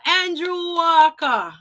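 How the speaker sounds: slow, high, loud Jamaican accent. Real, not acted. Host welcoming Andrew Walker back, Jamaican accent. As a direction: stretch the name out Jamaican-style. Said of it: "y'all think I'm Jamaican".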